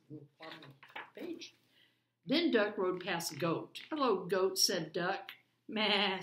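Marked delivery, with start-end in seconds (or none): 2.2-5.3 put-on lively read-aloud for children; story narration. A narrator's reading. Reads picture book aloud. 5.7-6.2 mild bleating meh; goat bleat. Acted. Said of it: "said Goat".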